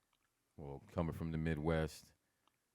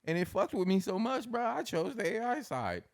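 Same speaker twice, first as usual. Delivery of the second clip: excited, much higher; 14 semitones higher, 6 dB louder than usual. Real, not acted. Excited retelling of game choice.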